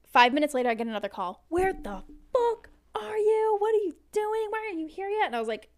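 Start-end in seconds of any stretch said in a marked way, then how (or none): 1.5-5.3 put-on whiny drunk boyfriend yelling down the phone, high and loud; imitating drunk yelling. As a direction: act out the wasted caller shouting at her. Drunk boyfriend's angry phone call.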